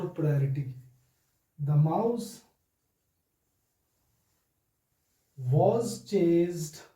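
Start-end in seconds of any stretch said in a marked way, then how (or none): 1.6-6.8 slow example dictation. Shown as a demonstration. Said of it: "this is what I do".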